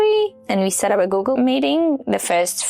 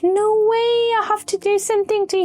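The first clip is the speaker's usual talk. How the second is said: high-pitched, rushing insistence; amazed protest, pitched way up; disbelieving protest; 13 semitones higher, faster than usual. Retold or imitated. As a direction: retell a stunned refusal of a gift. Retold disbelief at offered free help.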